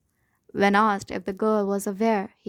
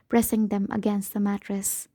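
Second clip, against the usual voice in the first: ordinary delivery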